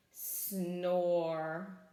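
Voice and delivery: long, drawn-out low snore word; heavy storybook snooze; sleeping bear. A narrator's reading. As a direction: stretch it out like a slumbering bear. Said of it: "said Bear".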